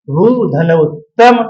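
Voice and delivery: भू with a long drawn ऊ, slowly; slow demo of a long ऊ; long vowel. Shown as a demonstration. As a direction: stretch the ऊ in भू slowly. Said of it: "ज्यादा time ले रहा है ना बोलने में".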